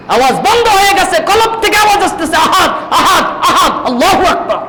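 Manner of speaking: high, shouted sermon climax; impassioned preaching. Acted. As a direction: cry it out high, punching each repeated word. Preaches at full pitch, shouting.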